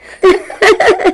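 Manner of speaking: a short giggling laugh; laugh, teased by a friend; giggle. Acted. Teasing between friends.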